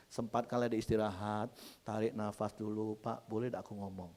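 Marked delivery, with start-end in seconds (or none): 3.0-4.1 soft, low, considerate asking; gentle request. Acted. Wife letting husband rest before talking, gentle request. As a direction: ask permission tenderly and quietly.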